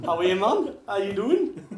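a loud, badly faked Welsh accent for the greeting; a hammed-up, not quite Welsh voice; borderline Welsh accent. Comic imitating a Welsh greeting to mum, borderline Welsh accent. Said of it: "I tried an accent. It was borderline Welsh".

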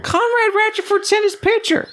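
very high excited burst; squealing with glee over the photo. Real, not acted. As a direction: shriek it with delight.

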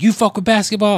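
high, loud mock challenge; ranting fan. Fan accosting a stranger at the bar, worked-up imitation.

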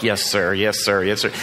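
low, dutiful mock deference; submissive compliance. Employee placating his boss to keep the job, retold submissive compliance. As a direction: lightly imitate a dutiful employee giving in. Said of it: "didn't want to lose that job".

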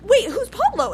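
high-pitched acted bafflement; mock confusion. Repeated puzzled question in story.

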